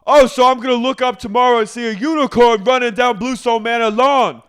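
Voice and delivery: high, full-volume sarcastic tirade; mock ranting yell. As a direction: shout the whole rant as an over-the-top impression. Imitating Dorinda's shouted rant.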